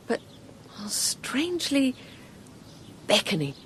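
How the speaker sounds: hushed wonder. Acted. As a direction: breathe it as if under a spell. Narrating first sight of cottage.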